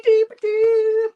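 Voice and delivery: "dee-ba-dee" stretched out slowly, very high and loud; slow goofy sing-song. Retold or imitated. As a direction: draw out "dee-ba-dee" like a slowed-down cartoon tune. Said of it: "He's like".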